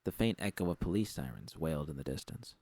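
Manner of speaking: ordinary delivery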